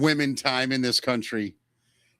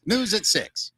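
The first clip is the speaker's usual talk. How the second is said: hammed-up vintage newsreel announcer, pitched up; old-time newscaster; 3 semitones higher than usual. Host wraps up the news at six, old-time newscaster voice. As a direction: sign off like a period news bulletin. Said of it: "I love that voice".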